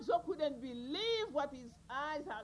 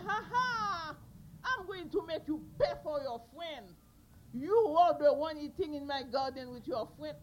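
gloating threat; 7 dB louder than usual. Acted. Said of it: "Kanzo said".

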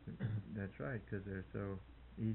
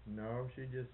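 normal talking voice

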